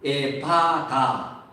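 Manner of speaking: 에파다 said very slowly, each syllable drawn out; easy-to-read articulation. Shown as a demonstration. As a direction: sound out 에파다 slowly for a lip-reader. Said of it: "알아보기 쉬운 발음을". (에파다를 한 음절씩 천천히 또렷하게)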